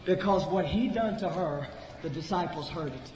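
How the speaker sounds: normal talking voice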